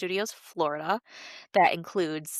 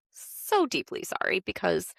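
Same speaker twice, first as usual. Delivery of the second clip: ordinary talk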